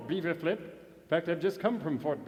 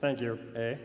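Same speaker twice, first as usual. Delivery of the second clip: Canadian Mountie reciting a ballad to music; rhythmic poem reading; ballad recitation; 5 dB louder than usual. Acted.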